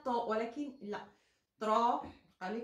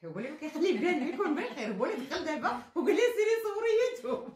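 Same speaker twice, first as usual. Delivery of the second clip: laughing; 6 semitones higher, 5 dB louder, much faster than usual. Real, not acted. Laughing fast joke.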